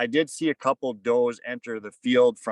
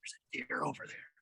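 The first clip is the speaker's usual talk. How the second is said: hushed whisper; breathy whisper so the deer won't hear; a boy whispering a sighting from the tree stand; 2 semitones higher, 14 dB quieter than usual. Retold or imitated. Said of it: "Cole leans over and he goes".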